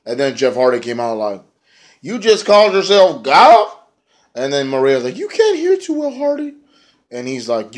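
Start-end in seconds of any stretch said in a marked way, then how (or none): 2.0-3.7 mock disbelief. Jeff Hardy mocks Bennett. 5.2-6.5 much higher voice, teasing Hardy; squeaky put-on female taunt; high mocking woman. Maria mocks Hardy.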